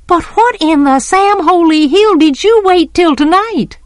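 high-pitched, indignant outburst; exasperated protest. A narrator's reading. Scout protesting the boys' night plan, exasperated. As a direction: protest in disbelief, pitched right up.

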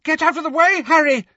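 high, loud urgent yell; yelled warning. A narrator's reading. Neville clearing his line of fire, urgent yell. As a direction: yell at your friend to move. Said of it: "yelled Neville".

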